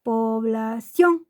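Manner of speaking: Población slowed, landing strongly on ción; stress on last syllable. Shown as a demonstration. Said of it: "esa es la tónica".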